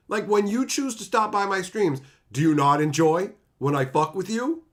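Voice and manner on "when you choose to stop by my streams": usual speaking voice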